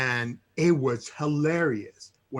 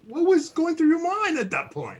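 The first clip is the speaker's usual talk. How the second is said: eager question; 13 semitones higher than usual. Real, not acted. Host pressing for the punchline.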